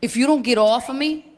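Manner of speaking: squared-up Italian uncle, rising high into disbelief; tough uncle. Retold or imitated. Uncle squaring up to the security guard, tough-guy voice. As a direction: threaten like a tough guy, then crack up. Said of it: "My uncle kinda squares up ... and goes".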